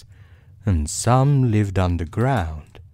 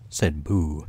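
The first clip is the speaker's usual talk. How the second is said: ordinary delivery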